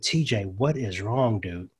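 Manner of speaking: quiet, tender concern, dropping away to almost nothing; gentle and soft. Real, not acted. Grandfather asking his upset grandson what's wrong, soft and gentle.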